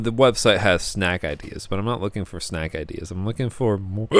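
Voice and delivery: ordinary talk, nothing marked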